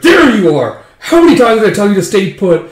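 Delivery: mock exasperated mom yell; scolding mother. Mother scolds runaway children.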